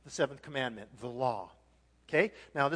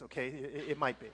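normal talking voice

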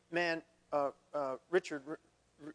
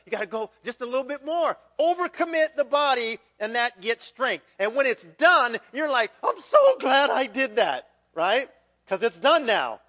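much louder, higher, driving the point home; loud, ringing pulpit exhortation; preaching at full force; 4 semitones higher, 10 dB louder than usual. Real, not acted. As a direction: build to a fervent preacher's cry. Preacher builds on overcommitting.

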